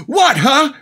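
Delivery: high, loud, baffled cry; confused outburst. Retold or imitated. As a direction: blurt it out in confusion. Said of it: "he goes".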